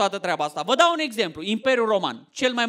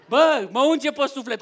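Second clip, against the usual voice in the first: ordinary talk, nothing marked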